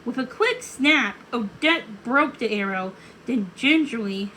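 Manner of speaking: ordinary delivery, nothing marked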